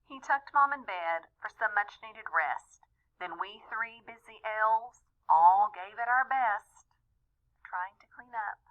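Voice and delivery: usual speaking voice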